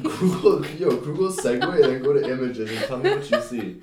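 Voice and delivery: loud. Real, not acted. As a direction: push it loud and teasing. Heated playful argument.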